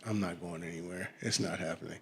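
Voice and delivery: low, very soft dismissal; mumbled to oneself, refusing to budge; soft, low-pitched deadpan refusal; quiet refusal. Retold or imitated. Acts out refusing to get up.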